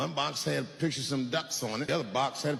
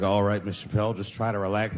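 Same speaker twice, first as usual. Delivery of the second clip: gynecologist; 7 semitones lower than usual. Retold or imitated. Gynecologist voiced in story.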